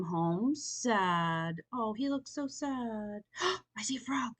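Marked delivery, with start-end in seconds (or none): 3.3-4.3 gasping, then a delighted I see a frog; gasp of discovery. Real, not acted. Reader spotting a frog in the picture, gasping. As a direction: catch your breath at the discovery.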